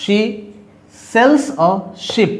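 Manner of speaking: drawn out word by word; slow demo read. Shown as a demonstration. Teacher modelling the sh sound for practice, very slow. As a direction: say it very slowly so they hear the sh. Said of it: "आपको भी practice करनी है".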